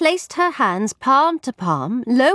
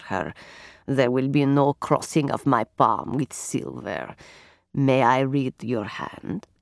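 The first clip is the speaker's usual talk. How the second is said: low softly rasping voice; 9 semitones lower, 4 dB quieter than usual. Acted. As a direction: speak like a solemn fortune teller. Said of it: "her low and softly rasping voice".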